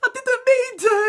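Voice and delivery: high whiny plea; hammed-up innocent excuse; mock pleading kid.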